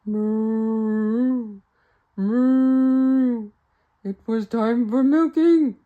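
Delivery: loud, slow mooing cow. Acted. Storyteller mooing as the cow calls for milking. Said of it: "the big bossy cow said".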